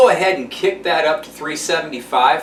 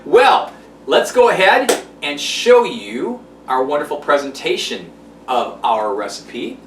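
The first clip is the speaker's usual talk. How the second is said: usual speaking voice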